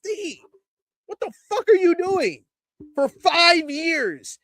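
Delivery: angry rant. Real, not acted. Host ranting to viewers about NXT, furious. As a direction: vent the frustration, pitch climbing.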